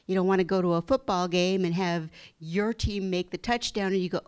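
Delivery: usual speaking voice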